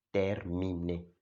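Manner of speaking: terminé said slowly, T with the tongue against the teeth; slow French terminé. Shown as a demonstration. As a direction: stretch out terminé. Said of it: "Con la lengua entre los dientes".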